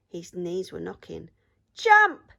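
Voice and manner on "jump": very loud, high yell; pirates' yell. A narrator's reading. Pirates ordering the troll off the plank, loud yell. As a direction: bark the order. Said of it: "yelled the pirates".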